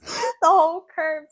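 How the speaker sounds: loud, high, laughing through the words; real laughing disbelief. Real, not acted. Woman tells her friend she was left curbside, laughing loudly.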